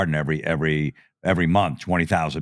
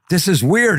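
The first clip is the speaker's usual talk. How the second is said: put-on high, louder "This is weird" as a shocked kid; weirded-out outburst; 10 semitones higher, 4 dB louder than usual. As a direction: blurt it out, creeped out. Creeped out by the photographer.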